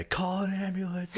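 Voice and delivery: high-pitched imitation of a distressed shout; mock cry for help. Retold screaming people outside.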